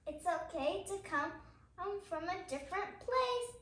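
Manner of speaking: steady, gentle picture-book reading; reading to children. Acted. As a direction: read each page out to little listeners. Reads picture book aloud.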